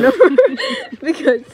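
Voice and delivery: words breaking up into loud laughter; real laughter while narrating. Real, not acted. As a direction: struggle through the line, laughing. Storyteller cracks up mid-tale.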